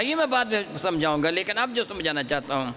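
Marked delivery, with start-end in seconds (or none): none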